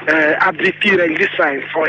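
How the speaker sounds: ordinary talk, nothing marked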